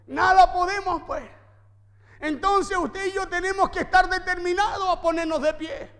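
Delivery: loud, driving sermon delivery; forceful preaching. Acted. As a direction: preach it hard to the congregation.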